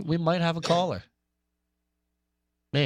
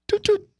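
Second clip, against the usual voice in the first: phone beeps; 5 dB louder than usual. Retold or imitated. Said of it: "imitating phone tones".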